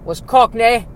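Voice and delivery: high, loud elf voice wobbling between bad Cockney and Southern accents; a house elf sliding from a hammed-up British accent into a Southern one; bad mixed accents. House elf explaining his family to the audience, in hammed-up mixed British and Southern accents. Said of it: "my long parade of bad accents".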